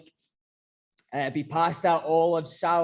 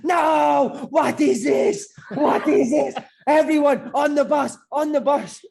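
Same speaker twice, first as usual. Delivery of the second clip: loud high mock-Italian shouting; furious rant; 7 semitones higher, 6 dB louder, slower than usual. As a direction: blow up at the players like a raging manager. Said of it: "he fucking flips".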